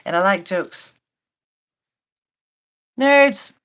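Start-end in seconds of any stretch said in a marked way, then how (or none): 3.0-3.5 calling out. Real, not acted. Calling out to chat viewers.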